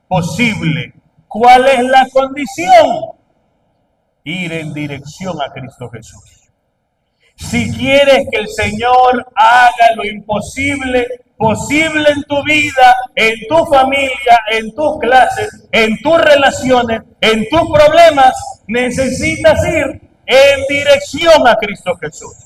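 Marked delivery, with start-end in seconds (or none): 1.3-3.1 loud, slow, higher call to the crowd; shouted question. Real, not acted. Preacher calls out question. 7.3-22.3 loud, rising preaching; full-force preaching. Acted.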